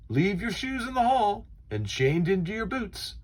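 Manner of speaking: teacher calling out to the class, high and brisk; teacher announcing. Acted. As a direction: address the whole class, high and clear.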